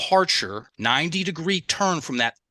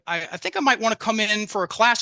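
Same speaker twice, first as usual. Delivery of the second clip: hesitant partygoer; 7 semitones higher than usual. Retold or imitated. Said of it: "someone said to you out at a party".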